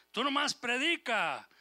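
God ordering Ezekiel, loud and slow; forceful command. Acted. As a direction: thunder it out like God's order. God's command to Ezekiel, preaching.

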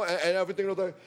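plain reading voice

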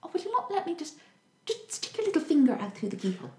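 high, sugary hammed-up pleading; wheedling plea. Wicked mother at the keyhole, high sugary wheedling. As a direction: coax the finger out sweetly. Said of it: "keeps begging and wheedling".

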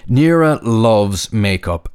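ordinary delivery, nothing marked

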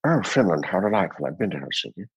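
languid screen villain, trailing off softly; lightly voiced impression, dropping away at the end; George Saunders. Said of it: "George Saunders looked like this".

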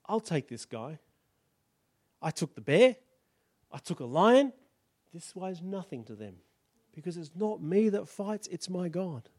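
calm certainty, no bluster; quiet confidence. Acted. David calmly confident.

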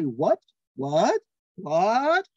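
one word said three ways, each rising; varied emphasis. Shown as a demonstration. Showing how English emphasis changes only emotion, rising. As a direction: tone demo for a word said three times. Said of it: "So you could say".

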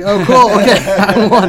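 loud, high retold reaction through laughter; laughing acceptance. Winner needing no proof from the store owner, loud and laughing.

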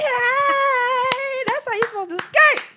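high mocking character voice; high movie voice. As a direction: squeak it like the movie.

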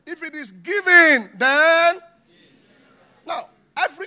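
slow, loud, high-pitched preacher's cry; preaching shout. Real, not acted. Preacher shouting to the congregation, loud and slow. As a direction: hammer it home from the pulpit.

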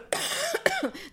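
sharp cough. Real, not acted. Streamer greeting anglophones interrupted by a cough. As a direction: cough mid-sentence. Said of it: "je tousse".

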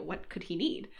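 ordinary talk

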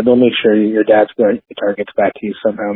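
ordinary delivery

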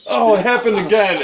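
high, loud mock dismay; mock alarm. Teasing the angry cohost with high mock dismay. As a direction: squeal it in fake shock. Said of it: "mocking cohost".

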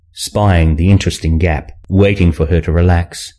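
ordinary delivery, nothing marked

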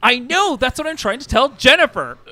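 high, loud, fed-up protest; exasperated yelling. Acted. Flustered employee explaining the lost venue, exasperated yell. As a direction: yell it in total frustration.

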